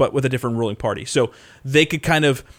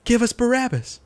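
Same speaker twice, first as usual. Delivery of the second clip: much higher mock chant of the mob; mock crowd shout; 12 semitones higher than usual. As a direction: throw it up high like a mob chanting. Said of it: "they start shouting".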